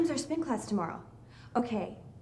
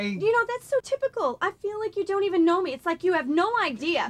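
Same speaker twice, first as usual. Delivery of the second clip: high, loud, fast complaint; angry rant; 8 semitones higher, 8 dB louder than usual. Acted. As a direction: pour it out in a rush. Couple arguing.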